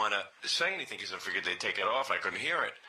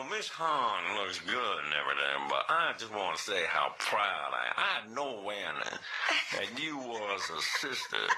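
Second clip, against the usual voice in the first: lower, slow, flattering caller; the Dwayne voice; 4 semitones lower, slower than usual. Pretend. Fake caller Dwayne flattering Robin in a low slow voice. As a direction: lay on the praise slowly, low. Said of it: "So I got the voice".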